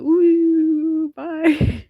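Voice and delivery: high, light "ooh, bye" as the wind; high playful farewell. Retold or imitated. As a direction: wave the house off in a high sing-song voice. House blown away by wind.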